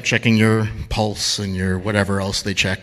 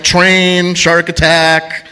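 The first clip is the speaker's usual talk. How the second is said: ordinary delivery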